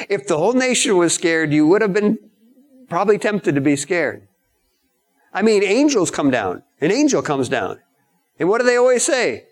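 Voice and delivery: ordinary talk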